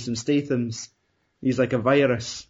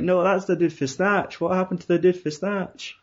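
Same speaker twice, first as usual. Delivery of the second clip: higher-pitched acted protest; mock disbelief; 6 semitones higher than usual. Fan protesting that it's the dude from Snatch, mock-incredulous. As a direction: question it as a baffled fan, pitched up. Acted viewer reaction to miscast actor.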